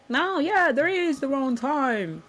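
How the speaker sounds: high, slow, put-on voice of a doubter pushing back; mock arguing listener. Imitating a listener who argues about timing, high mocking voice. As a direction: squeak out the objection like a stubborn skeptic.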